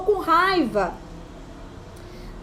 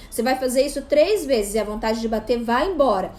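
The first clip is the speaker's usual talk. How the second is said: usual speaking voice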